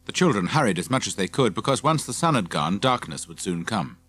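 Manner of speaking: ordinary delivery, nothing marked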